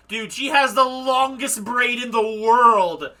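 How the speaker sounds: loud, high, astonished outburst; excited yelling. Real, not acted. Player marvels at the witch's long braid, excited yelling.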